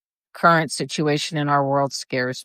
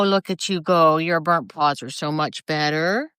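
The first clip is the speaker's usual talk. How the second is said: normal talking voice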